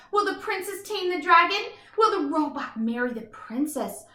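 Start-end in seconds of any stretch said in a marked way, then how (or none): none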